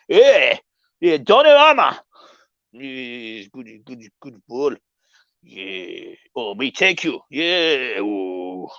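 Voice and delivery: lightly voiced Italian manager, murmured and trailing off; a hint of a laid-back Italian coach on the phone; gently mimicking a calm manager pleased with his keeper; Carlo Ancelotti impression. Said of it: "That was my impression of Carlo Ancelotti".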